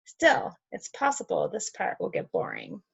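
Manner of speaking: ordinary delivery, nothing marked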